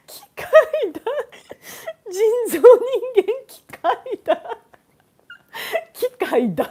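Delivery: high, loud words broken by laughing; hysterical laughter. Real, not acted. Streamer cracking up at a Japanese pun, hysterical laughter. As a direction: barely get the name out for laughing.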